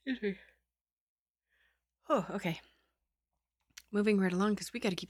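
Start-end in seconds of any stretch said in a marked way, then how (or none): none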